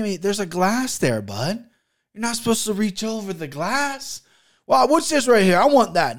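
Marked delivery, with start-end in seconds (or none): 4.7-6.2 rude customer imitation. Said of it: "all kinds of rude".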